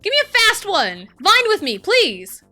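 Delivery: high, loud demand to the game; loud urging. Real, not acted. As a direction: shout it as an urgent plea.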